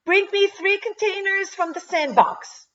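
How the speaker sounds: put-on high-pitched bossy child's demand; bossy order. A narrator's reading. Tara ordering Danielle to fetch containers, bossy. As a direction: order a playmate about in a high voice. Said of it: "Tara ordered".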